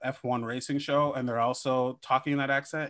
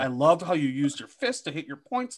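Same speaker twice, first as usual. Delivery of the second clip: higher-pitched, coaxing PR handler voice; gently steer the boss after his speech; buttering someone up before the awkward note; tactful PR person; 5 semitones higher, 3 dB louder than usual. Acted. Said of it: "he's got, like, a P R person pulling him aside".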